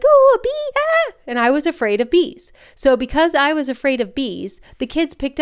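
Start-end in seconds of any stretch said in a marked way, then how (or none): 0.0-1.1 much higher, louder squeal of fake fright at a bee; mock bee panic. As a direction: shriek like a bee just dove at you. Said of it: "I'd be like".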